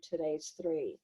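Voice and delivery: ordinary talk, nothing marked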